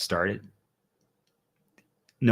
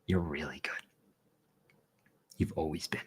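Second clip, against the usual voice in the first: soft unvoiced whisper, close and intimate; 4 semitones lower, 10 dB quieter than usual. Real, not acted. Speaking to the viewers, telling them they are good, in a whisper. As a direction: lean in and whisper it like a secret.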